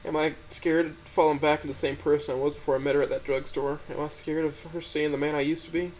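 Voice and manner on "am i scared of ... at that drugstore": ordinary delivery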